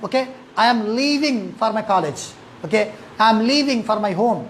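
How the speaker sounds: leaving with a long drawn ee; stretched long vowel. Shown as a demonstration. Teacher shows the class a long ee in leaving, slow. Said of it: "थोड़ा लंबा stretch करना है".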